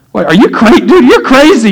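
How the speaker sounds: high, laughing disbelief; retold incredulity. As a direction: sputter it in mock outrage, half laughing. Retold incredulous reply to curser.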